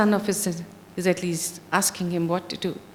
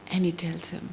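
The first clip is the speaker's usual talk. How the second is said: hushed whisper; 4 semitones lower, 7 dB quieter than usual. A narrator's reading. Hushed storytelling before divine speech.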